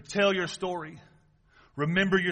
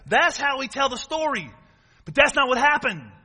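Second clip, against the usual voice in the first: louder, raised voice, punching each phrase; fervent preaching; 4 semitones higher, 6 dB louder than usual. Real, not acted. Preacher tells congregation how David really fought the bear, fervent preaching. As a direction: preach the fight with mounting force. Preaches the true story forcefully.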